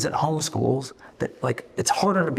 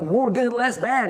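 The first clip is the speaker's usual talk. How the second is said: mock vague advice; 9 semitones higher, 3 dB louder, slower than usual. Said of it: "They're like".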